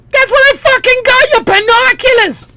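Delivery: very high, loud mock scream; hammed-up outburst at top of range; screaming Boston dad. Dad losing it over binoculars, shrieky mock fury.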